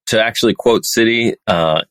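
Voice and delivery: ordinary talk, nothing marked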